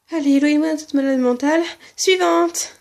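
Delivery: loud, fast, high mock panic. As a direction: flee in hammed-up panic. Pretend fright, moving on fast.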